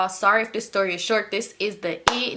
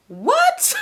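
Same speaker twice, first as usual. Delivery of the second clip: sharp high squeak of disbelief; shocked what; 5 dB louder than usual. Retold or imitated. Telling viewers how she reacted to him, high shocked disbelief. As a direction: fire it off like you've been insulted.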